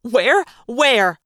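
loud, eager outburst; screamed. A narrator's reading. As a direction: shout the question frantically. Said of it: "Ty screamed".

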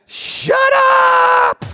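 very high, drawn-out yell; exasperated shout. Acted. Preacher silencing reasonable counselors, exasperated scream.

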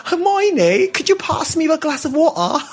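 imitating a British accent, laughing through it; a schoolkid's put-on British accent, giggling; mock British accent. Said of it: "trying to affect a British accent".

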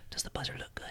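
hushed, intimidating whisper; menacing whisper. Pretend. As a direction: whisper it to rattle the opponent. Intimidating opponent on game show.

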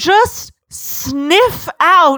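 very slow, savoring each word; mock-sensual slow read; slow sniffing Edward. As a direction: drag it out like a connoisseur. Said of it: "And Edward's like".

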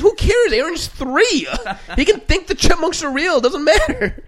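high, loud imitation of a mom; mom's voice. Son imitating mom scolding dad, high voice. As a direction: put on a high exasperated mother. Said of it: "She goes".

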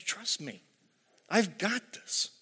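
a lightly voiced God, soft and steady; gentle reassurance. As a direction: hush it down and reassure.

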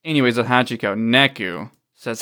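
ordinary talk

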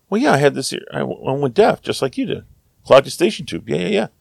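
high, airy imitation of a breezy traveller; carefree American traveller. Fellow hiker explaining her clogged ear, breezy and high.

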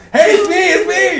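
high, loud acted shout; urgent calling out. Prankster revealing himself to terrified friend, urgent shout. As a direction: call out to stop someone's panic.

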